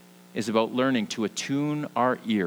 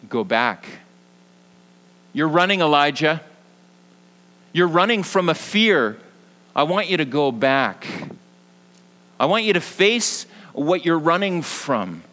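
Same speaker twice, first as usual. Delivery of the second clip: louder, higher urgent call; firm divine command; 4 semitones higher, 5 dB louder than usual. Pretend. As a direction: call him back with urgency. God speaking to Elijah.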